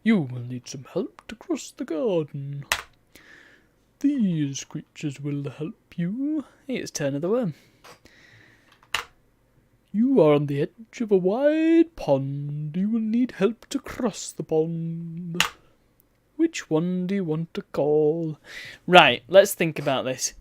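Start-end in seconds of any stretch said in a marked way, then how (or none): 0.0-2.7 slow, softer voice of a talking toadstool; a lightly voiced storybook toadstool, unhurried. Shown as a demonstration. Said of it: "I need to do it in his voice". 4.0-18.4 slow, slightly softer toadstool voice reading the game text; lightly voiced storybook toadstool, unhurried. Shown as a demonstration. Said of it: "I need to do it in his voice".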